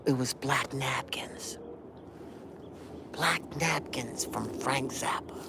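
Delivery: hushed, breathy reveal of the title; awed whisper. Real, not acted. Telling the listener the song's name, a reverent whisper. Naming the song in awe.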